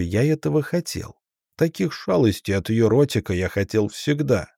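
ordinary delivery, nothing marked

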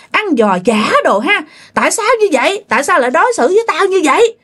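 high-pitched, indignant ghost rant; fierce scolding. Acted. Dead husband in a possessed neighbour scolding the feasting guests, fierce. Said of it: "bà rất hung dữ và bà chửi nha".

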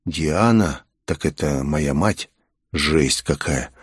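hushed, dropped-pitch realisation; stunned inner thought. A narrator's reading. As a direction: mull it over to yourself, stunned. Hero's stunned thought on hearing name.

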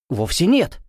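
high-pitched, alarmed denial; frightened. A narrator's reading. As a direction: deny it in a sudden fright. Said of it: "перепугался Чернопятов".

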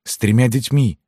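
high-pitched woman's disbelief; aghast canteen woman. A narrator's reading. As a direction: add it in a high, astonished voice. (маркитантка, высоко и изумлённо)

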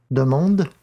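plain reading voice

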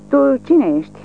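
întrebare lătrată, mai sus și mai tare; amenință-l cu fiecare cuvânt; voce primejdioasă. A narrator's reading. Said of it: "cu o voce primejdioasă". (locotenentul își interoghează amenințător prizonierul)

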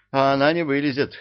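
fast, loud angry retort; seething bark. A narrator's reading. Man boiling over about the hiding girl, barking. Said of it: "рявкнул он, закипая".

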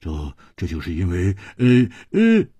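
halting, flustered explanation; helpless hesitation with repeated starts; tongue-tied stammering. A narrator's reading. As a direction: fumble for words and stall. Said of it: "几乎连舌头都快说断了".

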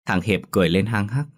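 ordinary delivery, nothing marked

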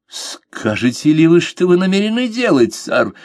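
high, demanding, shaking with rage; trembling with anger. A narrator's reading. Lord demands the captain explain himself, angry. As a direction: demand an answer, barely holding fury. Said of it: "потребовал его светлость, дрожа от гнева".

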